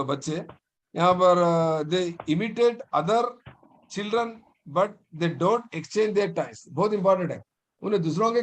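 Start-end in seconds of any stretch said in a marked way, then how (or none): 1.8-6.7 slow dictation. Shown as a demonstration. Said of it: "teacher dictating notes".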